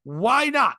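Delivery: usual speaking voice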